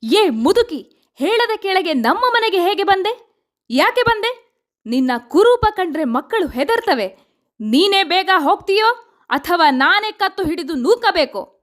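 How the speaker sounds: high-pitched, furious tirade; angry outburst, pitch soaring, harsh demanding questions; enraged roar. A narrator's reading. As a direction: berate and drive away an unwanted visitor. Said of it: "ಆತ ಅಬ್ಬರಿಸಿದ".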